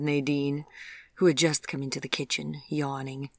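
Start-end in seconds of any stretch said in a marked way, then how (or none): none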